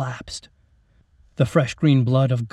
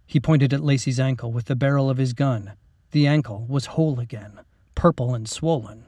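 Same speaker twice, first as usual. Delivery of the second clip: ordinary delivery, nothing marked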